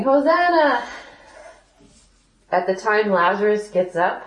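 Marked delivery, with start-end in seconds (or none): 0.0-0.8 cheering. Retold or imitated. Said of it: "everybody's going".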